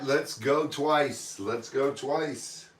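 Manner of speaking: slow, drawn-out auction call; slow auctioneer call. Acted. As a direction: call the bid slowly. Auctioneer calling the bid slowly.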